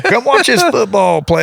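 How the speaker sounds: imitating grandpa calling him over to the TV; hammed-up eager old man. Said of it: "and he's like".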